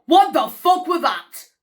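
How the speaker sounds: loud, high, drawn-out disbelief; real startled shock. Real, not acted. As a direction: shout in shock at what you saw. Startled by scary clip.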